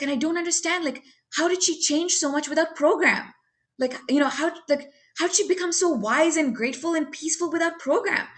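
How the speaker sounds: high, wondering question; amazed disbelief. Retold or imitated. Asking her sponsor in high amazed disbelief. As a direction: marvel at how she changed.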